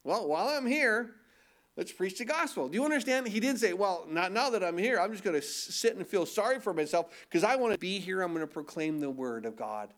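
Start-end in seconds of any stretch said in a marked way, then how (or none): none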